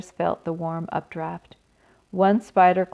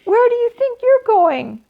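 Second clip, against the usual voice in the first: high, loud panicked cry; frantic scream; 7 dB louder than usual. Acted. Wilbur screaming as the baby spider sails away. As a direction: scream the question after him. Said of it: "screamed Wilbur".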